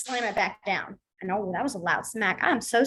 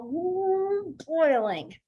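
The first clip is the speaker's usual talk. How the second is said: high drawn-out ooh dropping into boiling; hammed-up simmering anger; acted seething; 6 semitones higher, much slower than usual. Shown as a demonstration. Teacher showing students how to sign boiling, acted seething. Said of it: "but you're gonna be like".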